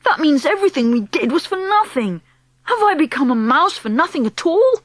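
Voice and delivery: a hint of an anxious child; upset young boy.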